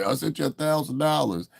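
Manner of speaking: high-pitched mimicking voice; mock lying donor. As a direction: imitate a boastful donor in a put-on high voice.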